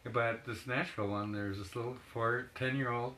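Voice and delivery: ordinary delivery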